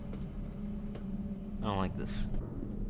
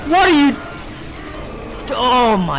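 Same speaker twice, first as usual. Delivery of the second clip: high, loud startled question; alarmed yelp; 6 semitones higher, 6 dB louder than usual. Real, not acted. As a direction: cry out in shock. Alarmed at game scene.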